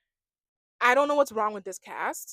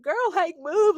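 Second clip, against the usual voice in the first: high, insistent acted order; mock impatience; 8 semitones higher, 5 dB louder, slower than usual. Friends moving the girl blocking the way, impatient. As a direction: imitate a friend shooing her away, pitched up. Friends shoving Melanie aside.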